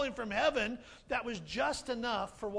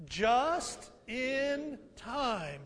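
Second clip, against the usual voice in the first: drawn-out call; much slower than usual. Shown as a demonstration. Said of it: "One more time".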